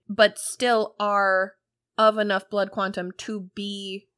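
normal talking voice

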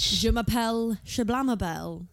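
slow mock French accent. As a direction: ham up the French introduction. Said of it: "had to do a French accent".

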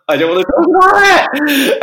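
a sudden high, loud squeal of embarrassment; mock squeaky whine. Guest at a party dodging the job question, in a high mock whine.

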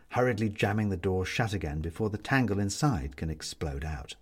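ordinary delivery, nothing marked

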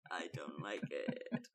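slow, low creaky voice; vocal fry. Shown as a demonstration. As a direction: let it crackle down low. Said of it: "this kind of voice".

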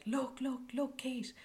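high, urgent half-whispered call; eager call. Retold or imitated. Sailor alerting Kate, eager call. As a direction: point it out eagerly.

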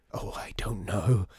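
slow, whispery pondering; hesitant whisper. Acted. Customer deciding what to ask for, slow hesitant whisper. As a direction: breathe it out slowly, unsure.

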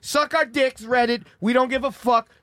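high, loud mocking defiance at critics; defiant mock rant. Comic telling online critics off, defiantly loud. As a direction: spit it back at the haters.

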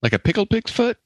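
high, disbelieving question; surprised. Real, not acted. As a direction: ask it high and startled. Disgusted surprise.

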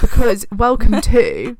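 loud, slow grand welcome; big announcement. Real, not acted. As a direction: announce it like a showman. Launching spooky Podtober announcement.

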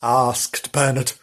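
ordinary delivery, nothing marked

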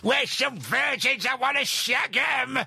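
high, drawn-out holler into the air; slow, high-pitched mock street shout; shouting old man. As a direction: imitate an old man shouting at nobody in particular. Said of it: "he was just shouting into the air".

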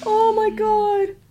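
high, drawn-out squeal; long high-pitched "oh my God"; appalled delight. Real, not acted. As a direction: wail it slowly in amused disbelief. Reacting to the mispronunciation story.

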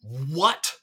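half-strength recalled yell; retold yell. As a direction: imitate yelling in disbelief, held back. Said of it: "I literally yelled".

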